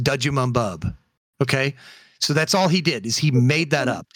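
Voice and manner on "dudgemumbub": dudgemumbub, each letter as its own syllable, dudge mum bub; fake foreign language. Shown as a demonstration. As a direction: spell dumb out as dudgemumbub, syllable by syllable. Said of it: "is pronounced".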